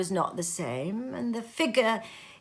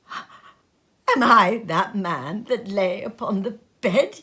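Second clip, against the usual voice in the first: rising to a frightened cry, louder; 6 dB louder, slower than usual. Acted. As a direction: cry out in dawning horror on his knees. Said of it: "he cried upon his knees".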